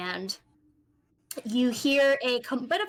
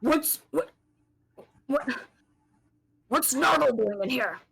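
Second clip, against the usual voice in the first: gruff loud voice; 3 semitones higher, 4 dB louder than usual. Acted. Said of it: "a loud, kind of gruff voice go".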